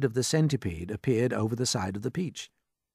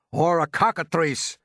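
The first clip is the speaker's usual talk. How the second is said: loud, slow, raised-pitch cry; police chief's yell; 6 semitones higher, 5 dB louder, slower than usual. A narrator's reading. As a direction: yell out another guess. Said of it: "yelled the chief of police".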